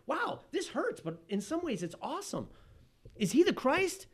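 mock awed crowd voice, pitch jumping high; excited crowd. Preacher voices the crowd gushing over John, excited. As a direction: act out the amazed listeners.

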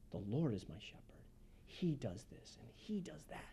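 whispered psalm, soft and intimate; quiet whispered prayer of David; hushed whisper. Acted. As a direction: breathe the words out in a whisper.

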